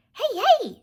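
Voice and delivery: high squeaky rat voice. Acted. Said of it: "he squeaked".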